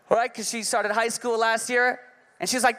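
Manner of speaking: usual speaking voice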